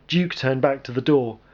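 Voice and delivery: ordinary delivery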